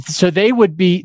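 normal talking voice

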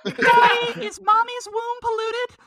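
put-on high, slow child voice; a small boy asking innocently; little kid voice. Timmy asking daddy a weird question, slow high child voice.

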